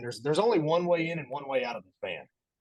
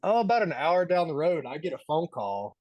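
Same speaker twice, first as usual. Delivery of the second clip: ordinary talk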